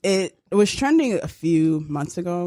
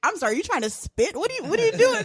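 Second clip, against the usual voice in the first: mock confused stranger, rising much higher; baffled listener; 10 semitones higher than usual. A stranger asking if she is trying to spit, baffled and high. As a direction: act puzzled at the noise, pitch climbing high. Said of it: "it's just like".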